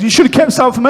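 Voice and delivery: angry friend. Retold or imitated. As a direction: act out a friend's indignant outburst, pitched high. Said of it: "Luke got angry".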